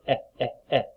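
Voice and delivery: short, separate "eh" sounds. Shown as a demonstration. Teacher demonstrating short E to pupils, clipped "eh". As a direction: say "eh" as in bed, each one apart. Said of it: "which is a short E".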